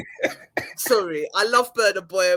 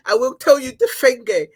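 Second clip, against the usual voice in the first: higher, louder mimicry of the ranter; mock war warning, pitched up and loud; imitating the ranter; 6 semitones higher, 5 dB louder than usual. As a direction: retell the threat in a raised, hammed-up voice. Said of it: "She was like".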